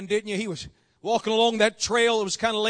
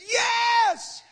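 high, stretched acted cry; desperate man's reply; 11 semitones higher than usual. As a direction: call back up from the cliff. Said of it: "The man says".

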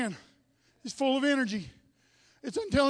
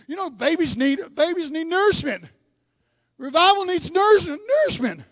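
ordinary talk

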